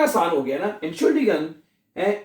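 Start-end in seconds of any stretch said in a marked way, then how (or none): none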